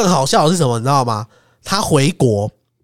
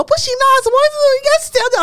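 much higher, hysterical protest; frantic yelling; 3 dB louder, much faster than usual. Retold or imitated. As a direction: keep shrieking in a panic. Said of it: "在那边大喊". (歇斯底里地大叫)

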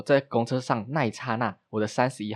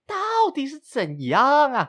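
exasperated outburst; 6 dB louder, slower than usual. Retold or imitated. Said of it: "然后就想说".

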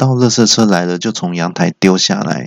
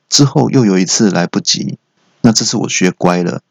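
ordinary delivery

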